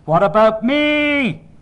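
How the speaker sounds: put-on high, loud, drawn-out baby's howl; screaming infant demanding attention; full-blast wail. A narrator's reading. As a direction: wail it out slowly at full volume. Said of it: "I was crying now, full blast. Everything was in my wail".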